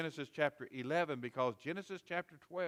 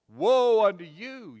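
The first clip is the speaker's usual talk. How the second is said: loud, slow, higher woe; Jesus rebuking the Pharisees, loud and slow; scathing rebuke; 6 semitones higher, 11 dB louder, slower than usual. Retold or imitated. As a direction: hurl the woe at them. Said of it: "He had scathing rebukes".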